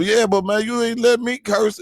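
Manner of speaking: high-pitched, wronged objection; aggrieved protest. Acted. Protesting to deacon friend about double standard, aggrieved protest.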